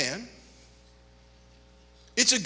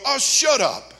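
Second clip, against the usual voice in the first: mock scolding; 7 semitones higher, 7 dB louder, slower than usual. Preacher scolds complainer.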